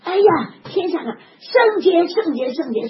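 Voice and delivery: high, loud exclamation of holiness; 圣洁 shouted high and loud; awed child's cry. Retold or imitated. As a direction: cry out like a child seeing heaven. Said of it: "第二句他说什么".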